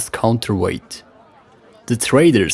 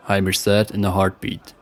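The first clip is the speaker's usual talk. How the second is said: plain reading voice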